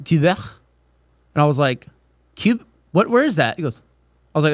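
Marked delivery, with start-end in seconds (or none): 0.1-0.4 put-on French Canadian accent. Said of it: "all from fucking Quebec". 2.3-3.6 high-pitched bafflement; puzzled. Retold or imitated. Student baffled by a teammate's hometown, puzzled.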